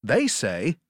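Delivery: normal talking voice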